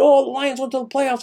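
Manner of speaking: high-pitched imitation of excited fans; mock excitement. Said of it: "Everybody's all excited".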